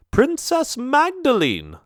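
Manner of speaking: very high, drawn-out princess voice; affected princess. Acted. Princess steps forward to introduce herself, affected and high. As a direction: sing out the name high and slow. Third princess announces her own name.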